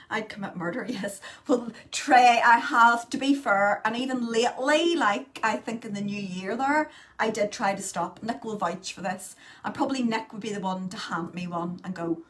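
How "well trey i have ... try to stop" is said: insistent. Real, not acted. Insistent defence on smoking.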